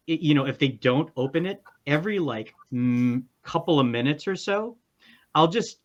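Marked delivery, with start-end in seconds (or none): none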